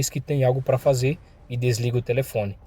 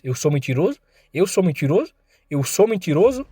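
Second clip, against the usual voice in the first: questions climbing higher each time; escalating taunt; 5 semitones higher than usual. A narrator's reading. Thug slapping the mourner, building taunting challenge. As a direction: needle him with rising, mock-incredulous questions.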